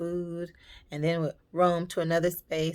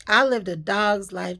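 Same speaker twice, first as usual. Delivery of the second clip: louder, punching I; mother declaring it firmly, stress on I; hammed-up mother hammering her point; mother insisting; 9 dB louder than usual. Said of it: "My mother used to always tell me".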